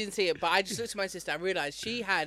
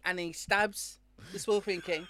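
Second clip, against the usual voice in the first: usual speaking voice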